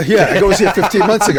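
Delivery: amused, laugh bubbling into the words. Real, not acted. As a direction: retell it while cracking up. Laughing while retelling own remark.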